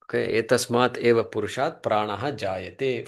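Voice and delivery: very slow chant-like reading of the Sanskrit; slow recitation. Shown as a demonstration. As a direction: read the verse slowly for the class to repeat. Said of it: "let's do the moolam here".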